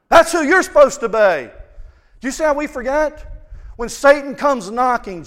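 loud, rising sermon cry; preaching at full force. Real, not acted. Preacher pressing the point on the congregation at full force. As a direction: drive it home to the congregation.

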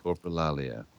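low, hushed perfume-ad whisper; sultry perfume ad. Acted. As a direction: purr it like a fragrance commercial. A new fragrance by Calvin Klein.

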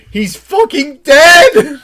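high, loud, slow drawn-out shout of joy; excited scream. Retold or imitated. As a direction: scream it out like a thrilled fan. Tweet voiced in excitement.

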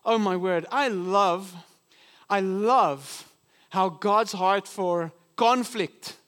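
ordinary talk

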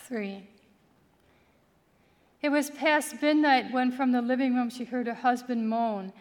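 usual speaking voice

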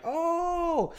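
high, drawn-out "ohh"; mock dread "oh". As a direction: squeal a long acted "oh" without much volume. Acted reaction to Kylo's look.

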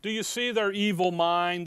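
emphatic prosecutor addressing the court. Acted. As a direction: press the case hard, drawing out the key words. Acting prosecutor addressing the judge.

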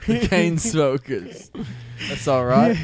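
drawn-out mock British band voice; British accent. Band member giving the new name, mock British accent. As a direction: stretch the name in playful British. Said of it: "it's a British thing".

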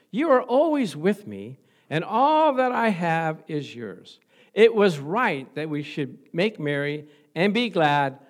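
tender father. Retold or imitated. Father gently answers son.

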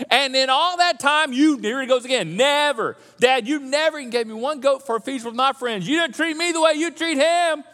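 whiny, indignant protest to Dad; older son complaining to his father, indignant; aggrieved son. Acted. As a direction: list every unfairness, voice rising. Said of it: "He goes on".

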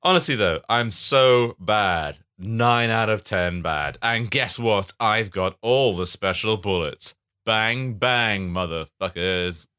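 slow, relishing villain brag; hammed-up, drawn-out baddie; badder bad man. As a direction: stretch the key words with mock menace. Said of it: "Badder and badder".

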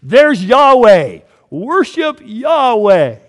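loud, slow mock cry of worship; hammed-up crowd hailing an idol; people shouting. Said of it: "And they go".

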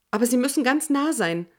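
insist, almost desperate; urgent insistence. A narrator's reading. Desperate protest to Jorge. (drängend, höher und lauter)

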